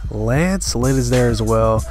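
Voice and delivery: ordinary talk, nothing marked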